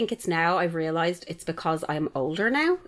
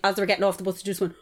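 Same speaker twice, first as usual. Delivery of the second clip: ordinary talk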